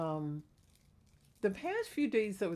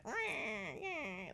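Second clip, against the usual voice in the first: put-on old lady homeless voice; 6 semitones higher, 7 dB quieter than usual. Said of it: "old lady homeless voice".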